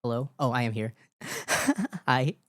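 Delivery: usual speaking voice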